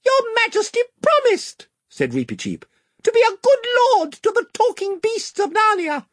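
very high, courtly little mouse voice; a tiny knight standing firm before his king; squeaky valiant mouse. A narrator's reading. Reepicheep the mouse speaking.

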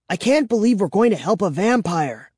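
ordinary delivery

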